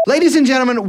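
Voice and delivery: loud, high showman's call; announcer. Pretend. Host introducing the episode's topic, big announcer voice. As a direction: launch the show like a ringmaster.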